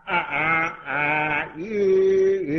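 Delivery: imitated empty droning vowels; mock kindergarten chanting. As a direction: drone the sounds like an LKG recitation. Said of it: "LKG గొడవలు అట్లా ఇవన్నీ".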